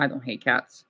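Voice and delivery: normal talking voice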